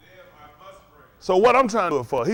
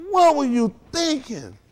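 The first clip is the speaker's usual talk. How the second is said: exasperated; 6 semitones higher, slower than usual. Retold or imitated. Preacher confronting his friend over the wreck, slow and exasperated. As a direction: drag it out in disbelief.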